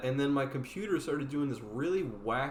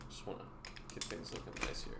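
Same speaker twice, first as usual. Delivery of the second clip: whispered aside; 8 semitones lower, 13 dB quieter, faster than usual. Real, not acted. Muttering while clearing desk.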